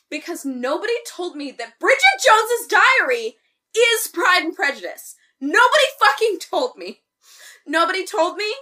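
high, loud comic indignation; mock outrage. Podcast host berates friends who never told her, hammed-up outrage. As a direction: rant as if personally betrayed.

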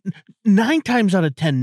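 ordinary talk

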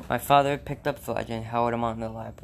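ordinary delivery

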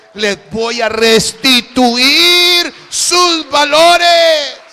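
slow, rising to a full booming proclamation; the Lord's voice. Acted. Preacher voicing the Lord to the congregation, building to a slow booming promise.

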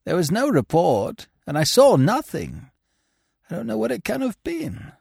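a shaken groom, puzzled and fading out; a hint of a hurt groom; injured groom. A narrator's reading. Groom character voice.

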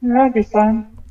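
lukewarm agreement in a level voice; unenthused. Real, not acted. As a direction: agree without much enthusiasm. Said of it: "You don't sound very enthused".

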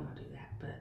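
whispered aside; whispering. Real, not acted. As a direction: say it under your breath. Embarrassed after letting something slip.

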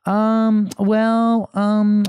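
put-on high, loud child's hemming; mimicked kid hunting for an excuse; imitated daughter stalling. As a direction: stall like a little girl caught out. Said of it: "And she's like".

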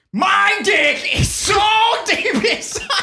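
high, slow squawking shout; Gilbert Gottfried voice. Acted. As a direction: screech it out like a cranky comic. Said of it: "In a, in a Gilbert Gottfried".

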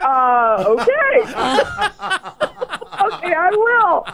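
high, giggling okay; laughing mock compliance. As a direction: go along with it while laughing. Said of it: "And then I was like".

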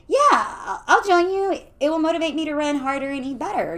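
high, loud, keen; eager agreement. A narrator's reading. Lucy agreeing to join her friends' plan, eager. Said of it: "agreed Lucy".

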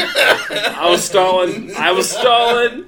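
high, loud and slow, through laughter; laughing confession. Real, not acted. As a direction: laugh while owning up. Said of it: "I gotta call myself out".

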